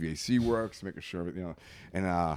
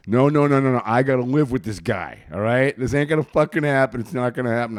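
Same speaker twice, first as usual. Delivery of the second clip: insistent raised-voice objection; roommate's protest; 3 semitones higher, 9 dB louder than usual. Retold or imitated. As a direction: act out a roommate loudly refusing. Roommate protesting in a retold scene.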